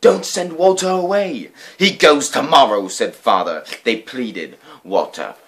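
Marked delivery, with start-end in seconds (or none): 0.0-1.5 put-on pleading child. A narrator's reading. 1.8-2.9 stern father. A narrator's reading.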